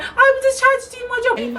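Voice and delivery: very high-pitched fake-cry wail; mock tearful whine. Shop worker defending herself in tears, mocking whine. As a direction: imitate a worker blubbering in self-defence. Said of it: "start bursting out in tears".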